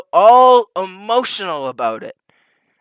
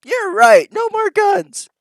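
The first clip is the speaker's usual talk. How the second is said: much higher, whiny voice of a dad caving to his wife; mocking meek dad; 4 dB louder, slower than usual. Streamer mocking a dad who obeys his wife, high whiny imitation. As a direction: play the henpecked husband, squeaky high and giving in. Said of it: "the dad ... will go".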